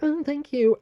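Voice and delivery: high, sing-song mock thanks; mocking imitation. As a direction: mimic him to make fun of him. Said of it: "mocked me and was like".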